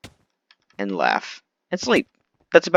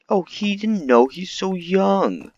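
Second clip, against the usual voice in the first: high, drawn-out pleading; mock the kids' excuse, high and slow; whiny excuse-making kid; 8 semitones higher, much slower than usual. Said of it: "kids always defend, like".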